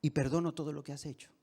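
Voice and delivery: low, soft and sincere; hushed, tender acted forgiveness; calm forgiving reply. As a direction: answer an outcry gently, voice dropped right down.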